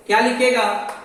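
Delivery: ordinary talk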